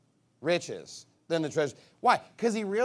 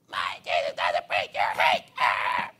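low, drawn-out mock bellow; screaming protester impression; 10 semitones lower, 4 dB louder, slower than usual. Preacher mimics a screaming critic, bellowed. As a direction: roar it slowly like an outraged heckler, ending in a yell. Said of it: "You're screaming at me".

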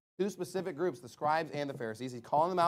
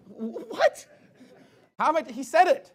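high, baffled protest; incredulous; 10 semitones higher, 7 dB louder than usual. Retold or imitated. Preacher retelling his reply to a theologian, incredulous. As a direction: squeak it out in loud disbelief.

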